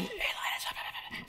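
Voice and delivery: hushed whisper; whispered kid voice. Retold or imitated. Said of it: "Charlie Brown and Linus would be having a conversation".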